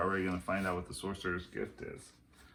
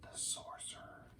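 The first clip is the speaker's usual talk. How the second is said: awed, breathless whisper; 18 dB quieter than usual. A narrator's reading. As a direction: breathe it in fear. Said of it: "whispered Jack".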